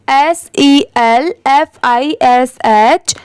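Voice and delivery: slow letter-by-letter spelling; spelling aloud. Shown as a demonstration. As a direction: spell it out slowly, one letter at a time. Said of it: "इसे हम क्या पढ़ेंगे?". (धीरे-धीरे अक्षर बोलकर spelling)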